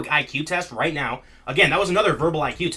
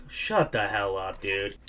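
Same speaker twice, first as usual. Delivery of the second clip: irritated dismissal; 7 semitones lower, 7 dB quieter, slower than usual. Real, not acted. Irritated at paywall notice.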